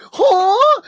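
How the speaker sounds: the slapped dog yelping in confusion; startled dog huh. Retold or imitated. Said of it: "And he's like".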